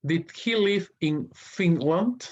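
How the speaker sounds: slow, high reading of the question; slow teacher read-aloud. Shown as a demonstration. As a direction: spell it out slowly for learners. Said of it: "teacher reading exercise question".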